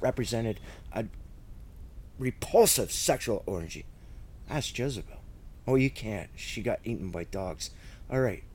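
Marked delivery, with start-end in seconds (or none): none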